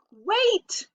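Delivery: put-on sudden high shout of a small boy. A narrator's reading. Little brother shouting to be heard at breakfast. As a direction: yell it out to stop them. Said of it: "Fudge shouted".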